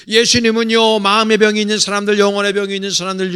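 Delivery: loud, ringing emphasis; emphatic. Acted. Preacher calling out to the congregation, loud and emphatic. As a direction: call it out to the whole church.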